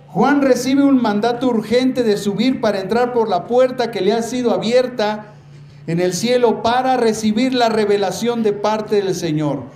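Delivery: usual speaking voice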